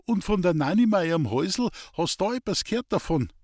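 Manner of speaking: a hint of a frail old Bavarian farmer; old farmer Hans-Peter. Old man in bed talking to Elisabeth, lightly voiced.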